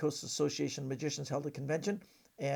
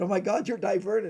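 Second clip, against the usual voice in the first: higher, louder acted astonishment; starstruck amazement; 5 semitones higher, 9 dB louder than usual. Young fan recognising his hero over the newspaper, acted amazement. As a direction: exclaim as if suddenly recognising your idol.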